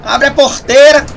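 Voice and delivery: high, slow drawn-out rodeo chant; rodeo announcer call. Pretend.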